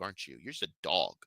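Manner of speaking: usual speaking voice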